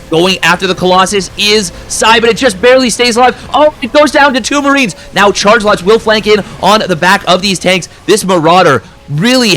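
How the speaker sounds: high, loud and fast caster peak; excited shout. Real, not acted. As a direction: scream the play as it barely survives. Caster at climax of fight.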